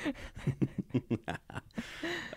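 high, quiet wheezy laugh. Real, not acted. Host laughs at muddling the goblin's name, breathy laughing. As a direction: laugh helplessly, mostly breath.

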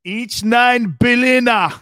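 high, long-drawn bellow; hammed-up German holler; mock German shout. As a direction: proclaim it loudly in comic German.

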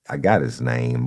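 ordinary delivery

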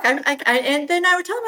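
ordinary talk

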